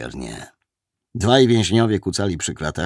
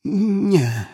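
frightened denial; 11 semitones higher than usual. A narrator's reading. Suspect denies roared accusation.

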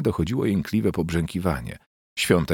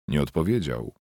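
usual speaking voice

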